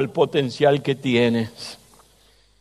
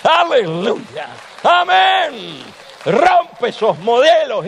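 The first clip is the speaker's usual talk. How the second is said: high, ringing preacher's call, slowing on the key words; fervent preaching; 7 semitones higher, slower than usual. Acted. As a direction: lift the congregation with a soaring alleluia. Raised preaching exhortation.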